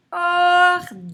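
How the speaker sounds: ordinateur chanted loud and high as or-di-na-teur; loud, held beats on or-di-na-teur; syllable by syllable. Shown as a demonstration. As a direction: stretch every syllable of ordinateur. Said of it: "on le tape".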